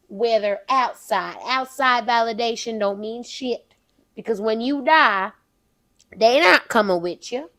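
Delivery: put-on Southern belle accent. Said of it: "The Southern Belle herself".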